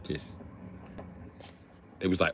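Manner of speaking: normal talking voice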